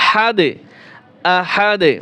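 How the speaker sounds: হাদে and আহাদে ending on a short bouncing d; qalqalah on d. Shown as a demonstration. Teacher spelling out the dal stop, echoed final d. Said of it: "কলকলা হবে".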